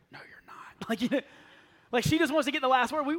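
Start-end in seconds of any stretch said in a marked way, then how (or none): 0.1-0.6 muttered reply. Retold or imitated. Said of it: "I can hear her go".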